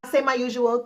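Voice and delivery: ordinary delivery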